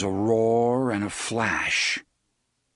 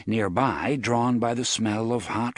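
ordinary talk

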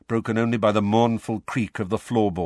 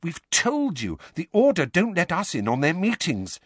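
high-pitched, nervous explanation; 9 semitones higher than usual. Acted. Hermione explains to furious Harry, nervous. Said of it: "said Hermione nervously".

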